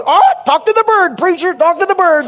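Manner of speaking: high, squawky pirate voice well above his own; pirate Rodney. Retold or imitated. Rodney dressed as a pirate greeting the preacher, high pirate voice. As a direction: play a pirate begging them to talk to his parrot. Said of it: "He goes".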